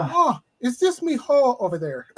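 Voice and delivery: high, loud mock Irish brogue, laughing; a laughing Irish brogue pimp voice calling out; Irish pimp impression. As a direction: ham up a stage-Irish leprechaun pimp in an Irish brogue. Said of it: "Lucky the Irish Pimp".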